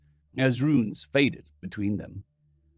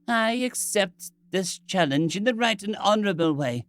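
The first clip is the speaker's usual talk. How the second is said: put-on young boy voice; 7 semitones higher than usual. Boy accepts, frightened.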